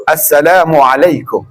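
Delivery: slow, careful "Assalamualaikum" with every syllable clear; proper full salam. Shown as a demonstration. Preacher showing the congregation the correct salam, slow and careful. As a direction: spell out "Assalamualaikum" slowly, each part sounded. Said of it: "Walau mana sebut betul?".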